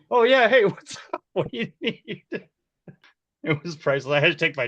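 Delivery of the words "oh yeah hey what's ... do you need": high, laughing fake-casual greeting; flustered laughing. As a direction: bluff through it while cracking up. Caught pretending to be Bill.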